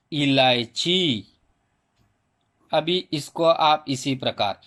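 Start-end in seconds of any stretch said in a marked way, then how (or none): 0.1-1.3 इलायची said slowly with a long final ई; slow model word. Shown as a demonstration. Teacher presenting the first word, इलायची slowly pronounced. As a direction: draw out the ची at the end of इलायची. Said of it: "अभी पहला शब्द है".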